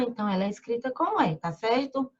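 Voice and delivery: normal talking voice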